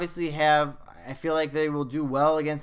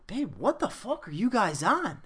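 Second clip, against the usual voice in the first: wife's incredulous question, higher and swooping; retold disbelief; 6 semitones higher than usual. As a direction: imitate a baffled wife confronting you.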